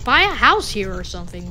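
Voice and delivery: usual speaking voice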